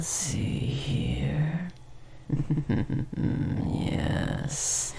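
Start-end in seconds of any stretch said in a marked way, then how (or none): 1.7-3.7 a hissing little laugh to himself; creepy chuckle. Acted. Creature laughs at human's discomfort, whispered chuckle.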